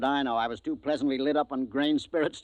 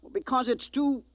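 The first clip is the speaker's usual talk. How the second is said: incredulous outburst; 14 semitones higher than usual. Acted. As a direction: voice jumps high, too wild. Scoffing at the egg theory.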